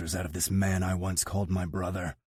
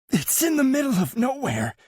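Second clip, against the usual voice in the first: high, louder protest; 6 dB louder than usual. A narrator's reading. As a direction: protest urgently. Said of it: "he protests".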